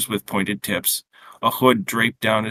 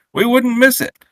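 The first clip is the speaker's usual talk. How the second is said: high-pitched, louder Quebecois grandmother; quaint Quebecois accent; 13 semitones higher, 5 dB louder than usual. A narrator's reading. Grandmother greeting her son's family, Quebecois accent. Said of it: "her quaint Quebecois accent".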